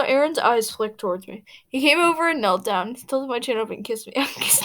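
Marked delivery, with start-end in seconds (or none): none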